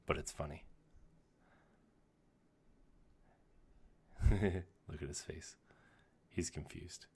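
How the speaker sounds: hushed golf commentary voice; golf announcer whisper. Real, not acted. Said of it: "like in my golf voice".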